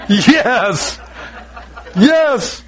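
gleeful, relishing yes; acted delight. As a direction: celebrate each yes as a little victory. Acts out joy at junk going.

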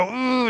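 high strained ooh while squeezing; straining ooh. Retold or imitated. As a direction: strain like you're squeezing hard. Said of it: "I've seen people just go".

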